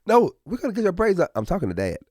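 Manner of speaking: high fast rushed mom voice; mock wife answering. Wife answering for daughter, high and rushed. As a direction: hurry in high and quick to answer for her. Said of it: "And she's like".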